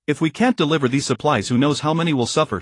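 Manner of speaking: ordinary delivery, nothing marked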